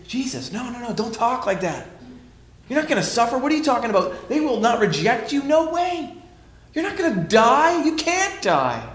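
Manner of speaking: high, pleading, disbelieving protest; Peter pleading. Retold or imitated. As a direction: beg him not to talk like that. Peter rebukes Jesus, pleading.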